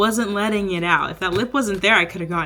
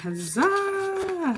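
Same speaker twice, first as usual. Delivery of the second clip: triumphant cheer; 12 semitones higher, 5 dB quieter than usual. Real, not acted. Triumph opening package.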